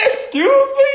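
very high, laughing excuse me; high indignant laughing gasp; outraged. Retold or imitated. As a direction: shriek it in mock outrage. Outraged at kid.

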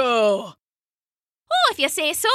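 light, high motherly voice; a mother covering for her baby; Una's sweet voice. Acted. Una humoring the giant.

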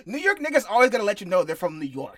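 teasing laugh. Real, not acted. Teasing friend about New York.

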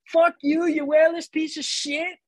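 high, drawn-out mock Irish insult; attempted Irish accent. Irish stranger insulting a Wales beanie wearer, high mock-Irish heckle. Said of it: "I can't even do an Irish accent".

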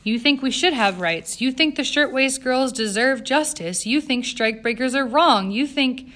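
high, loud, insistent argument; arguing. A narrator's reading. Jane pressing Eleanor about women's rights, arguing insistently. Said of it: "Jane argued".

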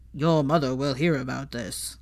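low, stern father threatening his son; a cold aristocratic threat; angry Lucius Malfoy impression. A narrator's reading. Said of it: "in a good impression of his angry Lucius Malfoy".

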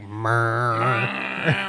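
long, droning didgeridoo buzz; didgeridoo impersonation. Shown as a demonstration. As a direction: hum and wobble like a didgeridoo. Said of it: "I can do a really good Australian didgeridoo".

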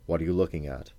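plain reading voice

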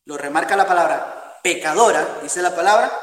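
ordinary talk

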